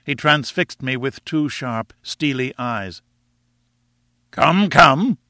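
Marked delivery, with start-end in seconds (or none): 4.3-5.2 loud, raised impatient prompt; impatient rumble. A narrator's reading. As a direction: cut through his stalling, loudly. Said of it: "he rumbled".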